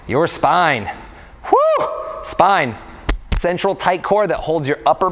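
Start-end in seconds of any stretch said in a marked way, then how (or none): 1.5-1.9 very high, loud "woo"; quick sky-high whoop; playful whoop. Pretend. Playful noise between spine words.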